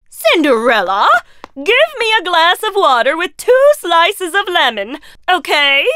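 high, loud, fussy demand; spoiled stepsister. Acted. Stepsister demanding lemon water from Cinderella, high and spoiled. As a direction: order water like a pampered princess.

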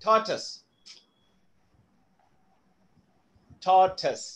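Tortoise said as TOR-tus; Tortoise in two clear syllables, ending in -tus; correct pronunciation. Shown as a demonstration. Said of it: "That's how it is pronounced".

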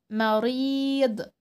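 مريض with a held vowel; long ii in مريض. Shown as a demonstration. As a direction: stretch the ii of مريض. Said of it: "بيطول صوته ازاي".

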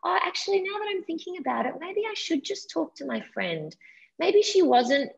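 high, breezy mock thanks; a sing-song mock of someone easily convinced; mock grateful naive voice. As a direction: play the person talked round to it, sweetly high. Mock naive person thanking Dylan.

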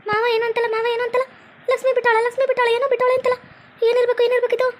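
a worried aunt asking what happened; fussing aunt. Acted.

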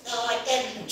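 a light impression of the friend saying no; imitating her friend. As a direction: pitch it up to play her flustered friend. Friend's quoted denial.

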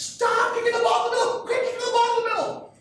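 high, loud sideline yell, imitating a coach; screaming coach. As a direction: yell it at the players like a worked-up soccer coach. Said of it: "screaming at the girls".

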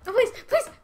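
high, loud, gasping pleas; panicked begging. Real, not acted. Player begging the game for mercy, panicked. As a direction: beg desperately between sharp gasps.

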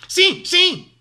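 high, loud squawk of agreement; squawking duck. A narrator's reading. Duck agrees with the dog, high squawking. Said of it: "schiamazzò Papera".

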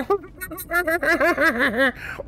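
high burst of laughter; high giggle. Real, not acted. Streamer laughing at his own imitation, high giggle. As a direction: laugh at your own silly voice. Laugh after mock quote.

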